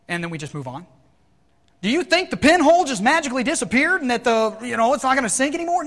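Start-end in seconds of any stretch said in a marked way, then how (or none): 1.8-5.8 loud, high rhetorical challenge; forceful preaching. Real, not acted. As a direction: throw the question out at preaching pitch.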